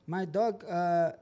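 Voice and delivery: imitando al dueño con un inglés roto, más agudo y algo lento; inglés chapurreado. Retold or imitated. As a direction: hazle hablar un inglés torpe de extranjero. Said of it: "no hablaba muy bien en inglés". (el dueño del perro pide un favor en inglés chapurreado)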